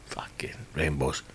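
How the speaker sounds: breathy whisper under a laugh; whispered aside. Real, not acted. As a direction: whisper it to yourself, amused. Muttered aside after story.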